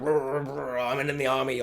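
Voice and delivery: low, slow grizzled soldier impression; mock a grizzly cowboy, drawn out and low; low, growly cowboy send-up. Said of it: "a grizzly kind of".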